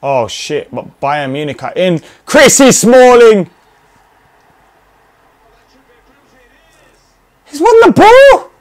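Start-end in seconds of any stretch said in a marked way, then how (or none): 2.2-8.5 high, loud shout; excited yell. Real, not acted. Gamer yelling at the screen as his defender wins the ball.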